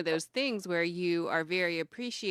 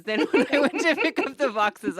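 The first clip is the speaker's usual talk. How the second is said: laughing through the words, higher and louder; 9 semitones higher, 9 dB louder than usual. Real, not acted. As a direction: let the laugh spill into the line. Laughing while telling story.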